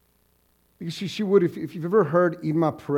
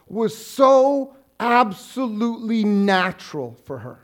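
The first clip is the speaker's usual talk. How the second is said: building, louder sermon voice; rising preaching; 8 semitones higher, 6 dB louder than usual. Acted. Pastor to congregation, building preaching. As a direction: preach it with swelling force.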